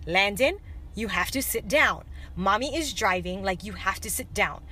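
low, soft, patient parent voice; acting out a mother calmly reasoning with her child. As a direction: keep it low, soft and controlled. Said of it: "I started in a very calm voice".

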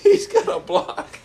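high squeaky laughing through words; laughing hard. Real, not acted. Telling friends the vacuum story, laughing hard. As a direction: barely get it out for laughing. Cracking up retelling.

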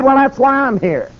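high-pitched, emphatic preacher's answer; retold bold declaration. Preacher answering the news media, bold declaration. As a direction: announce it with pitch way up.

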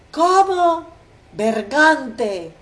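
sudden high, loud outburst of rage; outraged insult. A narrator's reading. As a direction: explode at the son in fury. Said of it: "insulta el padre Harpagón". (grito furioso de padre ofendido)

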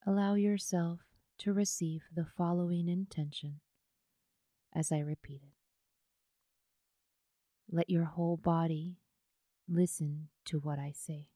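unhurried yoga nidra guidance, leaving space after each phrase; guided meditation. Acted.

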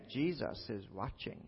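very hushed, drawn-out eerie voice; barely voiced, slow warning out of nowhere; odd mysterious voice. The same unseen voice warning the burglar, odd and very hushed. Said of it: "then he hears it again".

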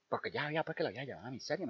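ordinary delivery

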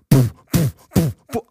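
imitated thumping bass beat; booming car bass. Imitating cars cruising by with loud sound systems, thumping bass noise. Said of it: "they've got these loud sound systems".

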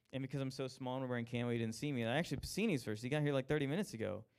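ordinary talk, nothing marked

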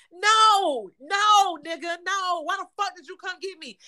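high-pitched, emphatic repeated refusals; retold outrage. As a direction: protest in disbelief, loud at first then easing off. Retold outraged refusal to his reply.

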